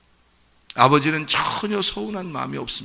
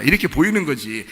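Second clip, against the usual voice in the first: ordinary talk, nothing marked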